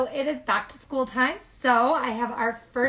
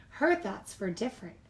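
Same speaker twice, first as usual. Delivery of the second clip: ordinary delivery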